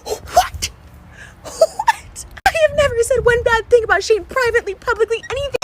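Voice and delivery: real crying, voice high and breaking. Real, not acted. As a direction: sob it out in disbelief. Hurt by friend's mocking voice memos.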